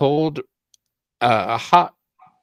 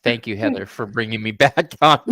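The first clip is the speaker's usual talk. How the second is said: ordinary talk, nothing marked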